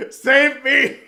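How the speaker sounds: high loud cry for rescue; pleading yell. Real, not acted. Desperate fan pleading to be saved, loud. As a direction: beg loudly to be saved. Begging for Rodgers trade.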